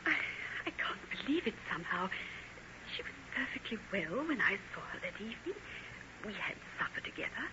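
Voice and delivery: soft, muted worried talk; hushed voices. Acted. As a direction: keep it down near a sick girl. Hushed talk about sick daughter.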